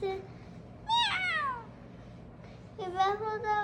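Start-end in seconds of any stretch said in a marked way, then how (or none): 0.0-1.6 high, soft little meow; cat meowing in the bedtime story; cat's meow. Acted. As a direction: mew like a small cat.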